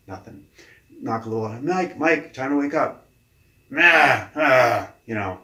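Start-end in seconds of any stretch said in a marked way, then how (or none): 1.6-3.1 raised, higher wake-up call; calling to a sleeper. Retold or imitated. Coach knocking to wake a client, calling out. 3.7-4.9 loud, higher groan of refusal; grumbling sleeper. Retold or imitated. Sleeping client refusing to get up, loud groggy grumble.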